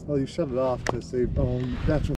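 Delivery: ordinary talk, nothing marked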